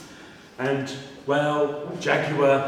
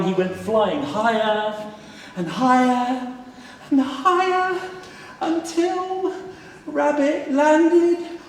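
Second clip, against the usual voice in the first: climbing higher and higher in pitch, slowing; rising higher; 10 semitones higher, much slower than usual. A narrator's reading. Narrator lifting the voice as rabbit flies to the moon, rising. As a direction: let the voice soar up with each higher.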